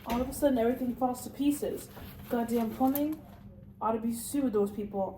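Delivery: ordinary talk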